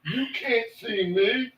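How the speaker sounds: John Cena catchphrase. Pretend. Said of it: "John Cena".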